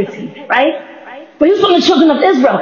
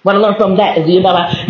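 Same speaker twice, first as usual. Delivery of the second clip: normal talking voice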